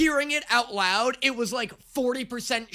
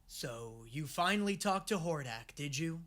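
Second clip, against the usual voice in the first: imagined villain chat; 6 semitones lower, 11 dB quieter than usual. Acted. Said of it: "she's just like".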